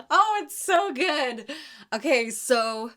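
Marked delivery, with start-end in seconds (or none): 0.0-1.5 high, slow words through laughter; can barely speak for laughing. Real, not acted. Laughing at the story.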